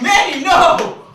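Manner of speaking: high, loud horrified yell; hammed-up horror; horrified shout. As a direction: scream at him to stop. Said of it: "I'm like".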